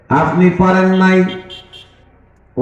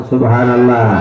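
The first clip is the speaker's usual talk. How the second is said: loud praise call; 4 semitones lower than usual. Shown as a demonstration. Preacher urges the congregation to answer louder, loud call. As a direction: call it out strongly to rouse the crowd. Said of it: "জোরে কোন".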